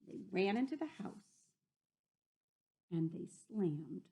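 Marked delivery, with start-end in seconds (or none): none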